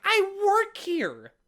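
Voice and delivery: very high, drawn-out protest; hysterical insistence. Retold or imitated. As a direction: stretch each word in an exasperated high cry. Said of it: "I get a little hysterical".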